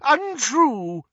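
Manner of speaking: very high, wheezing protest; strained, wheezy denial; wheezed. A narrator's reading. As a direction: wheeze it out in outrage. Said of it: "wheezed Doge".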